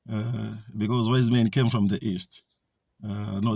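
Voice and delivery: usual speaking voice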